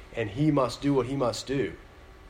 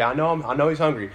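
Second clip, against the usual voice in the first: ordinary delivery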